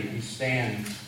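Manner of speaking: normal talking voice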